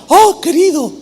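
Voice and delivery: high, fawning greeting; flattering. Acted. Absalom greeting people who approach him, flattering. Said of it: "con ese tono así lisonjero".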